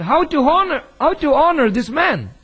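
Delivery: indignant protest. Retold or imitated. Son protesting to the pastor about his father, indignant and loud. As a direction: throw the question back, hurt and angry.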